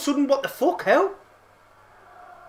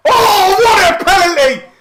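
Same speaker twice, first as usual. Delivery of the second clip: roaring with joy at the goal; ecstatic shout; 9 semitones higher, 7 dB louder than usual. Real, not acted. Fan at home roars as the penalty goes in. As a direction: bellow it out, slowly on the first words.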